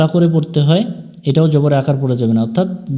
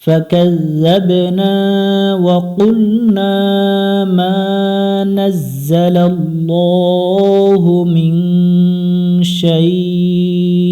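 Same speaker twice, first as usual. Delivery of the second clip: melodic Quran recitation; 3 semitones higher, much slower than usual. Shown as a demonstration. Teacher chanting verses slowly for students to follow. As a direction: stretch every vowel in a lilting recitation tune.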